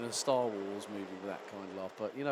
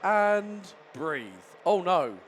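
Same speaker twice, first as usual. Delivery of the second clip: louder, higher excited race call; live play-by-play; 5 semitones higher, 9 dB louder than usual. Real, not acted. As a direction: call the start as it unfolds.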